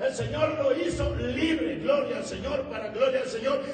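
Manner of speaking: high, slowing, exultant sermon cry; preaching. Acted. Preacher giving glory for the freed man, exultant preaching. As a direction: proclaim it with rising force.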